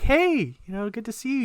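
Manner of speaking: very high, re-enacted hello; eager greeting. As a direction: pitch the hello way up, overeager. Acting out his greeting to her.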